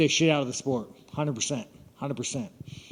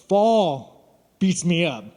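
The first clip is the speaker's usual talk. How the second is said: drawn out; 3 semitones higher, 4 dB louder, much slower than usual. Real, not acted. Exaggerated complaint about seasons.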